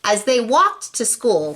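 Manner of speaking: ordinary delivery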